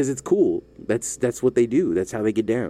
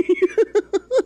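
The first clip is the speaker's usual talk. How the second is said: high laugh; 4 dB louder than usual. Real, not acted. Laughing at own remark.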